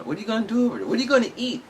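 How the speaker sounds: high, mocking voice of a baffled friend. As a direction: squeak it out like a worried friend teasing. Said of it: "and everybody's like".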